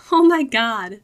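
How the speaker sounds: high, loud, thrilled gasp of disbelief; excited exclaim. Acted. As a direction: burst out with delight. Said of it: "Lily exclaimed".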